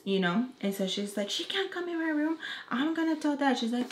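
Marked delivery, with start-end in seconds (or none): 1.3-3.6 put-on high whiny teen voice, higher pitched; whiny kid. Girl whining she will tell Dad. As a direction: whine like a caught kid.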